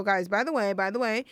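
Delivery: ordinary delivery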